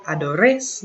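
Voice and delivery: "adores" ending in an audible "-ess"; wrongly sounded ending. Shown as a demonstration. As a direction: say "adores" as a learner's mistake, sounding the S. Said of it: "nothing like that".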